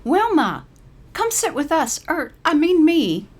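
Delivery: put-on high, bright old lady calling; Caroline's voice. Caroline invites Wilma to sit, high and bright. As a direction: wave her friend over eagerly.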